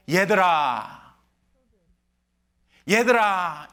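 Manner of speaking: slow, loving call; tender call to children. Retold or imitated. Jesus tenderly calling his disciples, slow and loving. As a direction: call them gently like a father. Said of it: "예수님이 나타나서 이렇게 부릅니다".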